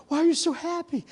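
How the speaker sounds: high-pitched, insistent question; baffled, high-voiced asking; exasperated curiosity. Asks again after repeated no answers.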